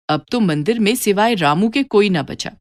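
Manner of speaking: usual speaking voice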